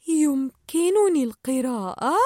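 slow, puzzled questioning repeat; confused echo. Acted. Dory repeats Marlin's question, confused and slow. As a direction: echo it back, unsure. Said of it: "كررت دوري وقد أربكها السؤال".

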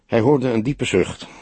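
ordinary delivery, nothing marked

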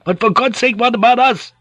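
very high, pleading outcry; anxious cry. Acted. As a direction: cry out as if being abandoned. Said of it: "Van Neck cried out".

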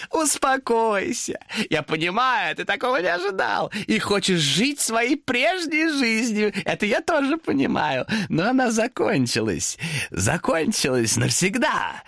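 high, sing-song demon voice; demon chiding. Acted. Demon chides Thomas in a sing-song voice. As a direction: croon his name mockingly.

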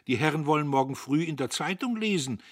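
like a petty official stressing his duty; self-important. A narrator's reading. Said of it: "wichtig wie ein kleiner Beamter".

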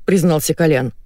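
usual speaking voice

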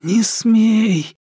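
usual speaking voice

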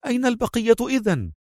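high, dismayed question; alarmed Mona. A narrator's reading. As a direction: ask in high-pitched alarm. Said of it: "سألته في جزع".

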